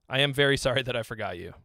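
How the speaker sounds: ordinary talk, nothing marked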